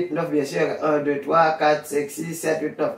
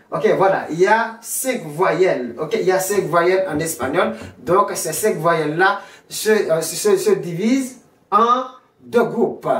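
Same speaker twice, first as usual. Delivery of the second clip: normal talking voice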